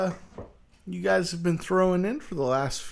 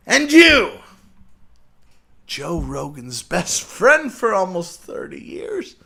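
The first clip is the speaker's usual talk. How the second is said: high, loud call-out; accusing shout; 3 semitones higher, 5 dB louder than usual. Acted. Turning on the other host, accusing shout. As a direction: round on him with a sudden yell. Turns rant on second host.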